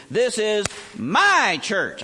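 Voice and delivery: "This is my church" slow and loud, "my" punched hard; stress on my. Retold or imitated. Preacher translating pastor's boast, heavy stress on my. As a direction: lean on "my" like a possessive pastor.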